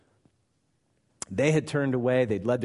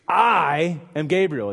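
"I" stretched long and loud, higher; stress on I; 5 semitones higher, 8 dB louder, slower than usual. Acted. Angel Gabriel answering Zechariah, booming emphatic "I". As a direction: hit "I" hard and hold it. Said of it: "It's emphatic".

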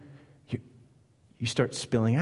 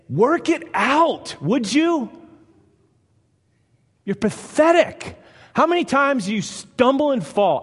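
much higher, louder, slow sneering taunt; mocking harsh God; 11 semitones higher, 5 dB louder than usual. Preacher mocking a harsh God who belittles stumbling believers. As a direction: shriek it like a contemptuous drill sergeant. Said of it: "He doesn't just say".

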